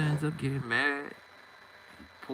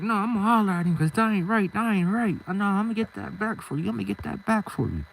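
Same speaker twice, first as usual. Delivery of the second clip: indignant promise; 8 semitones higher than usual. Retold or imitated. Friend vows to fix the wrong.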